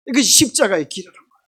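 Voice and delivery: ordinary delivery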